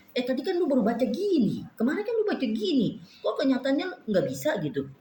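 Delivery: high, reproachful nagging; scolding reminder. Pretend. Her conscience scolding her, pitched high and reproachful. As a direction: needle yourself about failing.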